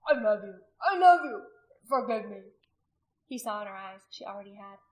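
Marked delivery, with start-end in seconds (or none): 0.1-2.5 loud, tearful declaration; distraught, emotional outburst; anguished pleading. A narrator's reading. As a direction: cry out love and beg forgiveness. Weeping husband begs forgiveness.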